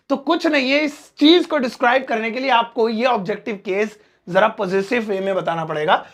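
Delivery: normal talking voice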